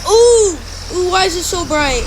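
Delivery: loud high ooh of surprise; surprised. Real, not acted. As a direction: caught off guard, gasp it out. Sudden bright sight in game.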